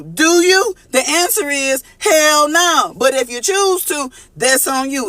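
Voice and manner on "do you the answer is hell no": high, slow, sassy hell no; higher, slowed, sassy put-down; emphatic retort. Real, not acted. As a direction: slam the answer down with attitude. Answering her own mocked question.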